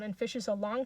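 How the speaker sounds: usual speaking voice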